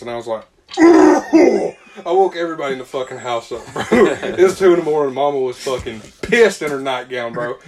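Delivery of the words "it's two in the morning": words pushed out through laughter; can't stop laughing while he says it; laughing, a bit louder. Real, not acted. Laughing while telling the story.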